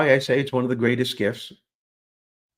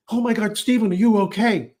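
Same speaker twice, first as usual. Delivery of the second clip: alarmed, much higher cry; worried teacher; 10 semitones higher, 5 dB louder than usual. Retold or imitated. Teacher running up the steps to the fallen boy, alarmed. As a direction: call up the stage in a panic. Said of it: "I hear her say".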